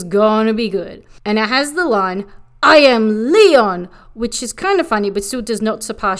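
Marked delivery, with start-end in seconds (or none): 2.6-3.9 loud, slow, drawn-out mock proclamation; grand declaration. Quoting [name]'s song line, grandly declaimed. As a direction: announce yourself like a song's big line. Quotes the song's bold line.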